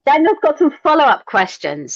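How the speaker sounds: high, loud gleeful emphasis on some; excited repeat. Real, not acted. Excitedly echoing the host about Daniel's questions, high and loud. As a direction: jump in and punch the line up high and loud.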